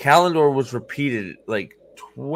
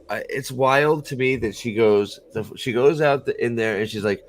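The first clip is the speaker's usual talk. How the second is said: ordinary delivery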